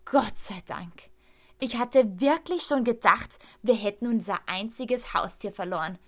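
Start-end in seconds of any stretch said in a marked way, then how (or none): 0.0-1.1 slow, breathy sigh of relief; relieved whisper. A narrator's reading. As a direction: whisper it as the fear drains away. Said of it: "atme vor Erleichterung auf". (erleichtert geflüstert, langsam)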